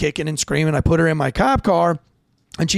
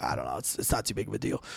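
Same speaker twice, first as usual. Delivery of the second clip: much softer and lower, said low under the breath; quietly to the medic, lower and softer; hushed aside; 9 semitones lower, 13 dB quieter, faster than usual. Retold or imitated. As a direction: play it down so the patient won't hear.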